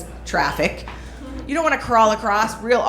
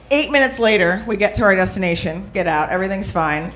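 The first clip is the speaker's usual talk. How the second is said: ordinary talk, nothing marked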